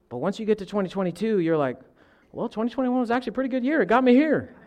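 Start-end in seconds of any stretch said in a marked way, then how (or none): 2.3-4.6 high-pitched, quick acted quote; acted pleasant reconsidering. Someone looking back on a bad year, high mock reconsidering. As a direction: lift the voice high and rattle it off.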